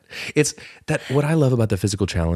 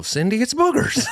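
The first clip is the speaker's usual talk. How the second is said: a higher put-on whiny voice; mock Cindy voice. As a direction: squeal the title like Cindy. Show title in Cindy voice.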